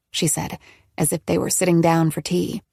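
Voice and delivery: ordinary delivery, nothing marked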